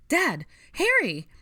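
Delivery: high, loud alarmed cries; girl's urgent high shout; crying out. A narrator's reading. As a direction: scream out for your family. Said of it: "Ginny cried".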